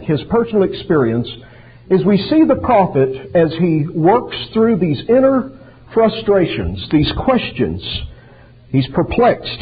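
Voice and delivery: normal talking voice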